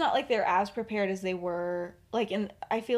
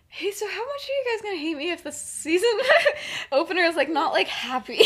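much higher, laughing apologetic question; sheepish laughing; 9 semitones higher, 9 dB louder than usual. Retold or imitated. Writer asking fans about a sad opener, laughing and higher. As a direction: giggle through the guilty question.

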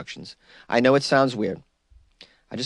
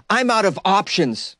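mock quote; 7 semitones higher, slower than usual. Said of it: "one more quote from Ollie".

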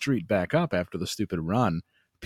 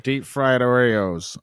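slow, louder drawn-out mom answer; bored mom between cigarette puffs; lazy mom; 3 semitones higher, 6 dB louder, slower than usual. Retold or imitated. Mom answering her kid between cigarette puffs, mock lazy drawl. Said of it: "mom's like, between puffs of a fucking Virginia Slims".

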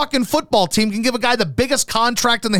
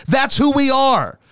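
ordinary delivery

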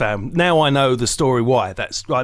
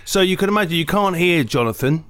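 usual speaking voice

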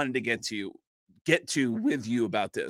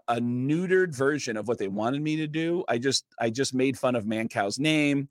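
usual speaking voice

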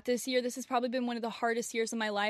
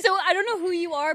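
laughing; 6 semitones higher, 7 dB louder, faster than usual. Real, not acted. Laughing shout-out to listener.

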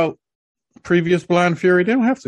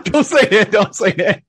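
loud protest; 7 semitones higher, 5 dB louder than usual. Real, not acted. Cohost protests, laughing.

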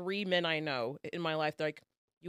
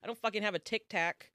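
normal talking voice

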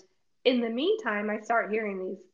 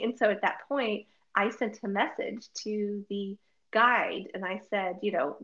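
ordinary talk, nothing marked

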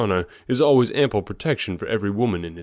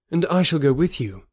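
Carthoris; 7 semitones higher than usual. A narrator's reading. Carthoris speaks.

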